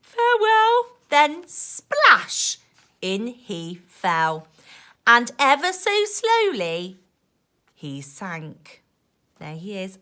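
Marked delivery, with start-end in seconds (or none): 0.0-1.0 high, louder sniffly goodbye; a pirate waving farewell with a sniffle. Acted. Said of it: "Pete sniffled". 1.1-8.8 put-on lively rhyming read-aloud for kids; reading to children. A narrator's reading. Reading rhyming picture book aloud.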